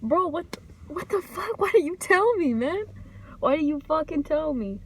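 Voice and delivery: shocked outburst. Retold or imitated. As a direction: act out being blindsided by your partner. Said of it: "And I was like".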